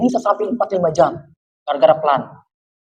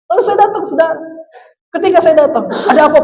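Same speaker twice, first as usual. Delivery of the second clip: laughing; 8 semitones higher, 3 dB louder than usual. Real, not acted. Laughs while retelling.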